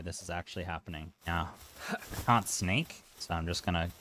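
ordinary delivery

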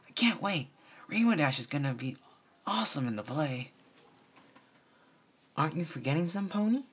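high, bright excited filly; young filly voice. A narrator's reading. Crusaders chattering about the play, high and excited. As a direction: play an eager little pony.

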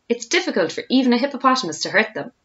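ordinary delivery, nothing marked

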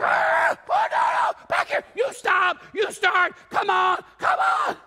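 a panicked leader yelling orders; frantic drum major. Retold or imitated. Drum major frantically shouting her band back into line.

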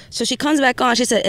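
normal talking voice

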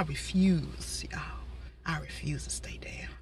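soft whispered vow; whispering. Real, not acted. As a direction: breathe it out in a whisper. Whispered resolve.

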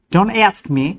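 American reading; American accent. Shown as a demonstration. Teacher says it the American way.

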